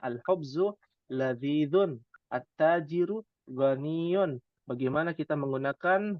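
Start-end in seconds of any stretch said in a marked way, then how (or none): 0.0-4.4 slow, careful Arabic reading of the example sentences; deliberate classroom recitation. Shown as a demonstration. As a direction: read each Arabic word clearly for students. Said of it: "seperti ini contohnya kalimatnya".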